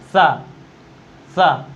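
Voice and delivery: सा with the tongue against the teeth; dental sa demo. Shown as a demonstration. Teacher demonstrating dental सा to students. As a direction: hiss सा off the teeth. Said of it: "कैसे?".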